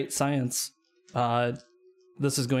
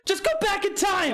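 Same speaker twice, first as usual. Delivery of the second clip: exasperated shout. Retold or imitated. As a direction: yell it like you're fed up. Guardian rant peaks.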